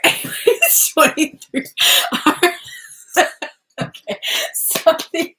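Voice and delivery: real giggles breaking up the words; laughing. Real, not acted. Reader cracks up after family interruption, laughing. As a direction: talk through real laughter, high and loud.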